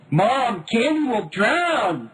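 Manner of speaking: put-on high, loud, slow childlike wail; young girl wailing to mom about the duck; wailing child. A narrator's reading. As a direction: plead through tears like a little girl. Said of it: "Carrie wailed".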